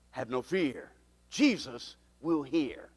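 reassurance. Real, not acted. Reassuring the congregation.

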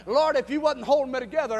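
ordinary talk, nothing marked

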